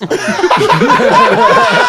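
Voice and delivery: big high laugh bursting out; real loud laugh; loud burst of laughter. Real, not acted. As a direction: crack up hard at your own line. Cracking up at own story.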